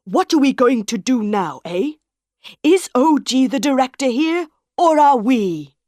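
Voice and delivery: furious director raising his voice; angry shout at his partner. A narrator's reading. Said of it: "Monsieur Armand shouted".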